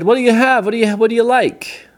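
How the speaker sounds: high-pitched, tumbling questions; hearty, insistent host; eager offering. Acted. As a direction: offer excitedly, voice way up. Giant eagerly offers Pedro food.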